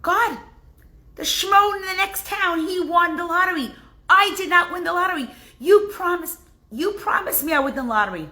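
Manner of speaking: a high, aggrieved Chaim; indignant complaint. Retold or imitated. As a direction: Chaim protesting to God. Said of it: "Chaim says to God".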